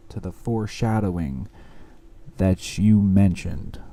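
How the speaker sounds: measured, rhythmic recitation on an even pitch; spoken-word poetry. Acted. Poet confessing struggles to loved ones, spoken-word recitation. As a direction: deliver it as a heartfelt spoken-word piece.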